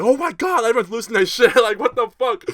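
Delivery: high, loud mock-shocked outcry; imitated crowd freak-out. Crowd seeing his broken teeth, imitated shocked uproar. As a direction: exclaim like stunned onlookers losing it.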